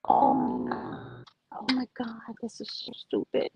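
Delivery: annoyed whisper under the breath; whispered frustration. Real, not acted. Cleaning the drenched table, a whispered complaint to herself. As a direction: mutter it to yourself, fed up. Muttering while cleaning up rain.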